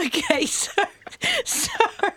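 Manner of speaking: high, squeaky giggling apology; helpless laughter. Real, not acted. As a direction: try to carry on through real laughter. Helpless laughter derails the timeline.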